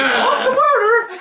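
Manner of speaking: high, slow, laughing sing-song; chirpy and cheerful. Retold or imitated. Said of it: "they're just like".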